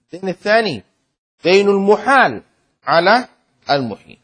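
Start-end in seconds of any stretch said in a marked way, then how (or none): 1.4-4.2 louder, stress on المحال; loud teaching emphasis. Shown as a demonstration. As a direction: press the term home. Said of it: "فهمتم ولا لا؟". (تشديد بصوت عالٍ)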